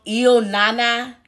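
Il Nana, slow, with a clear short "ill" at the start; a slow teaching demo of Il Nana; Il Nana said slowly. Shown as a demonstration. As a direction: draw out Il Nana as a model. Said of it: "when you say Il Nana".

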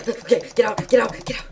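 urgent, rising repeats; frantic repeats; urgent get out. Real, not acted. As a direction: hurry yourself out. Panicked escape from mobs.

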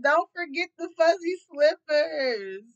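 high, loud, drawn-out mocking quote; mock man's request. Reading the man's card note, loud mocking voice. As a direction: ham up his request, slow and loud. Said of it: "This man said".

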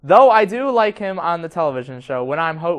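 ordinary talk, nothing marked

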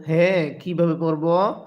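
usual speaking voice

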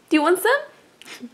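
drawn-out coaxing, stress on want; asking the cat again, slow and playful. Real, not acted. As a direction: tease it out slowly. Offering again, slower.

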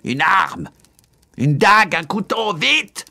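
very high, urgent cry; frantic shout. A narrator's reading. Charles demands a blade to kill his brother, frantic shout. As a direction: shriek for a weapon in a rage. Enraged king calls for a weapon.

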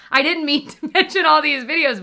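laughing. Real, not acted. Laughing at her own plugs.

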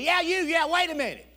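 loud, high insistent call. Retold or imitated. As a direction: flag someone down urgently with your voice. Stranger calling him back.

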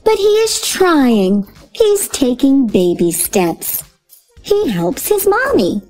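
plain reading voice

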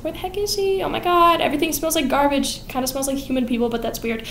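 put-on high, scatterbrained muttering old woman; beggar woman voice.

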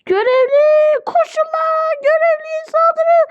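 high, shrieked orders to attack; rally the mob in a high mock scream; play-acted battle cry. Player urging others to attack the attendant, high frantic yell.

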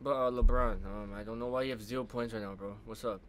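low, very soft question; timid. Pretend. Fan carefully asking LeBron about zero points, meek and hushed. Timidly re-asks after apologising.

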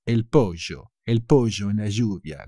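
Argentine pollo and lluvia with a sh-like ll; Argentine accent. Shown as a demonstration. Teacher imitating an Argentine speaker, Argentine accent. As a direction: say el pollo with a rioplatense sh. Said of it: "they will make sh out of it".

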